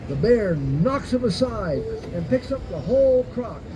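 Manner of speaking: plain reading voice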